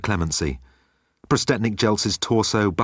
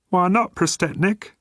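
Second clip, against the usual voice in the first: high meek crewman; high-pitched yes-man gunner; gunner's voice; 12 semitones higher than usual. Acted. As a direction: agree timidly.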